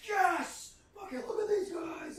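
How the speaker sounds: high-pitched whooping townsfolk; crowd cheering. Acted. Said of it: "a cheer starts to raise up".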